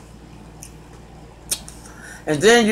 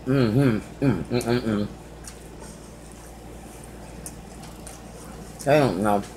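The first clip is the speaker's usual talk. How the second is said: chewing while talking; muffled speech through food; mouth full; 6 semitones lower, 11 dB quieter than usual. Real, not acted. Seen 0: food put in mouth about -3; cheeks full, chewing until 6.0.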